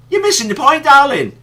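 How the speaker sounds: high-pitched, exasperated scolding; exasperated retort. Retold or imitated. Ranter answering the woman in the documentary, exasperated. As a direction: tell her off in your head, exasperated. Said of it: "and I'm thinking".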